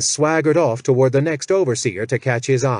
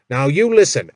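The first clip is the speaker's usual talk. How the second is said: much higher and louder commanding shout; angry orders; 6 semitones higher, 6 dB louder than usual. Acted. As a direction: bark orders at the cringing orc. Jack as Malmore gives orders.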